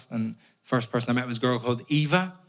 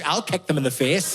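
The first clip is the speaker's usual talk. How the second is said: ordinary talk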